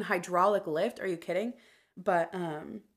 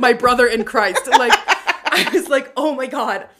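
laughing disbelief; 5 semitones higher, 14 dB louder, slower than usual. Real, not acted.

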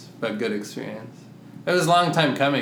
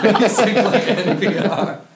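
higher, louder laughing repeat; 8 semitones higher, 5 dB louder than usual. Real, not acted. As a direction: crack up as you say it. Laughing echo of joke.

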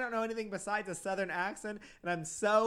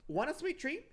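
ad read; 10 semitones higher than usual. Acted. Ad read pitch.

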